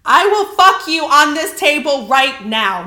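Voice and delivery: loud, slow, hammed-up declaration; mock lustful shout. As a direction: blurt it out loudly as a joke. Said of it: "No, I'm kidding".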